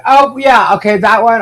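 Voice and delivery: high, loud oh yeah of recognition; sudden realization. Real, not acted. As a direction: remember it all at once. Suddenly recalls the clip.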